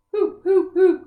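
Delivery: high, very loud sobbing hoops. Acted. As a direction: sob it out like a heartbroken little fox. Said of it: "sobbed the fox".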